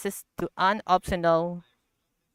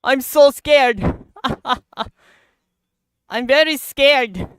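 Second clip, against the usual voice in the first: mock scared; 5 semitones higher, 8 dB louder than usual. Laughing after the blast.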